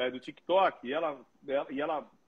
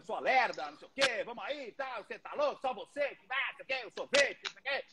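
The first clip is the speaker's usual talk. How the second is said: mock sisters teasing; 5 semitones higher, 4 dB quieter than usual. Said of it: "minhas irmãs brigavam comigo, me xingavam, tipo".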